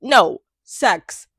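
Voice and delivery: ordinary talk, nothing marked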